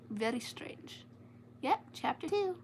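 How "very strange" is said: whispered aside; a hushed little aside. Real, not acted. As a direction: whisper it to yourself. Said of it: "unvoiced whisper".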